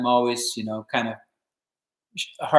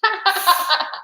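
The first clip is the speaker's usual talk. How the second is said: high, delighted little laugh; high gleeful cackle. Retold or imitated. Guitarist mimicking B B King's laugh, high and gleeful. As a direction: cackle like the jig-dancing bluesman.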